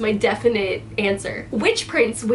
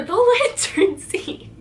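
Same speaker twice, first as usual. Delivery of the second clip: high giggly repeat, laughing through it; giggling; 10 semitones higher than usual. Real, not acted. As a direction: laugh it out, much higher.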